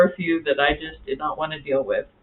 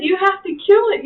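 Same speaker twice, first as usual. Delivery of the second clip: pleading; 14 semitones higher, 5 dB louder than usual. Retold or imitated. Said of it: "I said".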